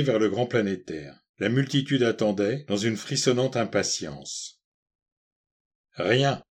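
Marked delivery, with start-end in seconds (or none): none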